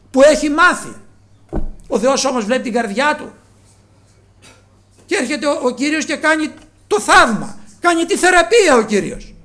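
loud, rising sermon questions pressed on the flock; preaching at full force. Acted. Preacher asking the congregation why they came, loud preaching. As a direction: call out to the whole church, demanding an answer.

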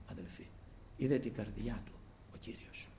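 hushed whisper, breath only; whispered «Είδε την καρδιά του», no voice at all. Acted. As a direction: lean in and whisper it as a secret. Preacher drops to intimate whisper.

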